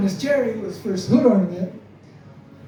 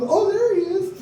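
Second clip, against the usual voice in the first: high, sing-song mocking voice; mocking kids; 11 semitones higher than usual. Kids teasing the nerd once he takes the glasses off, in a high sing-song voice. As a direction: tease him like a schoolyard bully.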